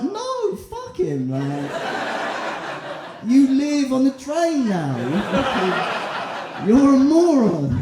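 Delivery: high, laughing mock rant; mocking a child left behind on a train; mock outburst. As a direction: jeer at the kid, half laughing. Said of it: "I'm like".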